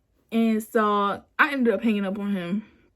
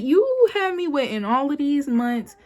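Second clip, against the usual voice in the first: indignant retort; 5 semitones higher, 4 dB louder than usual. Retold or imitated. Retold angry reply to boyfriend.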